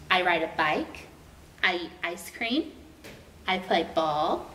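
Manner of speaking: plain reading voice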